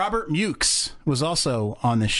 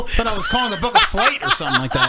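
words bubbling with laughter, much higher; laughing while talking; 4 dB louder than usual. Real, not acted. Host laughs to the guest about her greeting. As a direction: laugh your way through it.

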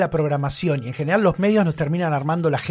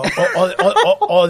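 nervous laugh; 5 dB louder than usual. Real, not acted. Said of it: "yo me río de nervios".

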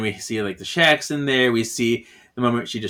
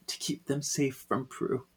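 very soft, choked-back line; mock tearful; 12 dB quieter than usual. Host voicing Piper after breaking down, quietly tearful. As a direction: say it softly, as if just done crying. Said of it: "Piper just breaks down crying".

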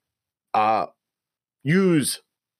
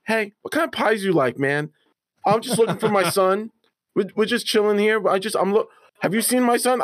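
lightly voiced mellow old man; a hint of a chilled-out father searching for his son; casual, higher, easygoing lost dad; laid-back old dad; 7 semitones higher than usual. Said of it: "he was like".

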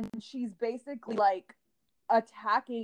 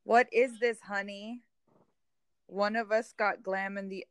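normal talking voice

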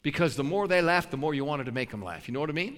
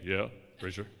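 a lightly lowered, softer big-man voice; 8 semitones lower, 5 dB quieter than usual. Retold or imitated. Big guy answers the preacher in a big voice. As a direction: mimic the big guy's booming reply, understated. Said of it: "Big voice".

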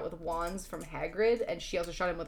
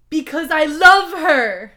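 high, loud, drawn-out declaration of love; impassioned outburst; 9 semitones higher, 16 dB louder, slower than usual. Acted. Story character confessing love for Ebony, impassioned shout. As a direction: belt it out melodramatically.

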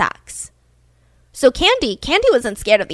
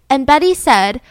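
ordinary delivery, nothing marked

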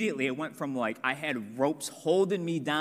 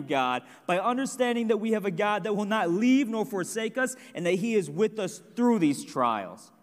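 raised, ringing preacher's voice, higher and louder; preaching at full force; 4 semitones higher, 3 dB louder than usual. Acted. Youth pastor urging listeners to trust God, full preaching. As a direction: drive each point home to the congregation. Preaches his third point.

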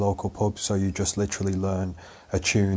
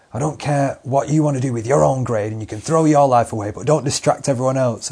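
imitating a teacher telling off, higher and louder; exasperated teacher; 5 semitones higher, 7 dB louder than usual. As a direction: scold like a fed-up teacher. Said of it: "and the teachers, they go like".

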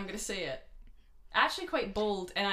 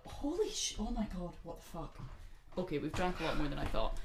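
ordinary talk

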